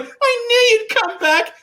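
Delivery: high, slowed, teary whine; whiny Luke. Retold or imitated. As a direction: ham up Luke's crying reunion line. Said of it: "He's like".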